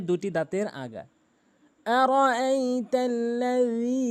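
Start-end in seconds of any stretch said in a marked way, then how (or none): 1.9-4.0 আরাআইতাল্লাযী recited very slowly with a light রি; slow melodic আরাআইতাল্লাযী; light ri, one alif. Shown as a demonstration. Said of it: "রি একদম হালকা করে".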